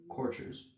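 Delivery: courtiers whispered as kor-chers; whispered pronunciation. Shown as a demonstration. Reader tries the word privately, whispered. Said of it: "pronounced that word".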